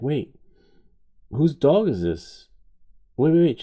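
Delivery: loud, high, demanding wife; alarmed disbelief. Retold or imitated. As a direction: imitate a wife stunned by a surprise dog. Wife confronts him about dog.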